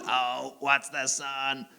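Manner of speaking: slow, measured robot monotone; dad's slow soothing robot act. Acted. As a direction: talk like a reassuring robot, word by word. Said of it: "I talk and act like a robot".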